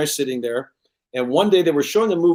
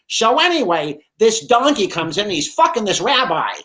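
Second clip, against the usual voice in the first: higher, louder hammed-up Buddy Hackett telling a filthy joke; imitating Buddy Hackett's dirty joke delivery, pitched up; Buddy Hackett impression; 8 semitones higher, 4 dB louder than usual. Son imitating Buddy Hackett telling jokes at dinner, hammed up. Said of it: "you do a great impression".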